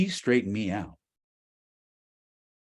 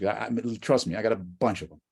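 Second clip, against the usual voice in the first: ordinary talk, nothing marked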